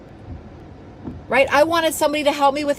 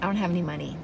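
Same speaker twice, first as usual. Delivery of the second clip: small, low, soft mock excuse; meek excuse; 6 semitones lower, 7 dB quieter, faster than usual. Friend answers an offer of abundance, meek and quiet. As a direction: mimic a feeble excuse, hushed and low.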